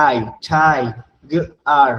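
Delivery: ঠাই and গার sounded apart, then joined slowly into ঠাইগার; syllable split. Shown as a demonstration. Teacher dividing a word into syllables for children. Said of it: "syllable division".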